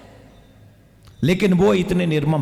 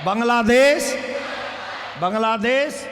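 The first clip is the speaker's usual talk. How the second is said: booming political rally speech; 2 semitones higher than usual. Real, not acted. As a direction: lead the crowd in slogans. Leading rally chants.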